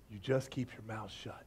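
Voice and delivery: low, very soft, held-back warning; quiet, controlled threat; restrained anger. Acted. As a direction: say it under the breath, barely contained. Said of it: "he said it through clenched teeth".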